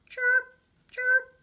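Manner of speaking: high, loud chirping cricket. Acted. Narrator voicing the cricket friend, high chirpy cricket. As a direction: squeak it out like a tiny cricket.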